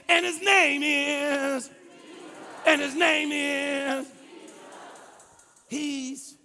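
slow, emphatic phrases rising to high, loud cries; building preaching. Real, not acted. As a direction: hammer each point, stretching the last word. Preaches at full force.

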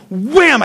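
sharp imitated wham of a hit; mock impact. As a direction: punch out a comic impact noise. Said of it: "And he just goes".

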